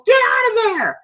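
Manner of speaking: high, urgent mock shout; retold yell. Corner yelling as Rocky gets hit, mock shout. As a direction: call out like a panicked cornerman.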